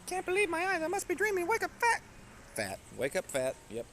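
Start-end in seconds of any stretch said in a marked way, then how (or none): 0.1-1.5 squeaky high voice. Pretend. Totally fucked that up. 1.5-2.0 "Wake up, fat" squeaked very high and loud; squeaky high take. Shown as a demonstration. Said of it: "Totally fucked that up".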